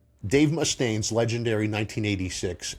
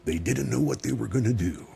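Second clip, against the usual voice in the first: lightly put-on movie trailer announcer; dramatic trailer narration, understated. Shown as a demonstration. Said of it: "my announcer voice".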